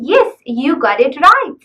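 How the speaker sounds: normal talking voice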